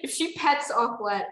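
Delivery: ordinary delivery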